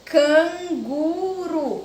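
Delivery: "canguru" very slow, can-gu-ru; syllable by syllable. Shown as a demonstration. Teacher showing that the word has three syllables, drawn out. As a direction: stretch out each beat of "canguru". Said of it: "três sílabas é trissílabas".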